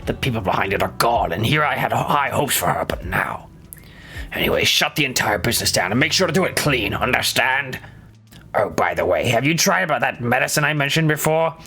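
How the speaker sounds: low, cold boss voice dropping to whispers; menacing villain. Acted. Streamer voicing a ruthless boss, low and cold.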